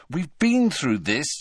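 exasperated and cross. A narrator's reading. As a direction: say it crossly, drawing it out. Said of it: "said Harry crossly".

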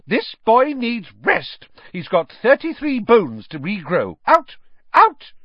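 high, loud matron building to a yelled Out; shouting. A narrator's reading. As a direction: drive the visitors out, shouting.

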